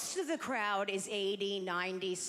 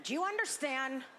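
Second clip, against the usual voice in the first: ordinary delivery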